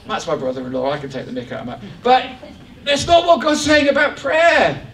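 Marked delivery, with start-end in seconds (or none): 2.0-4.9 loud, high, leaning on not; firm preacher's shout, not punched; preaching shout. Real, not acted. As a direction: drive the point home with a shout on not. Preacher shouts the correction again.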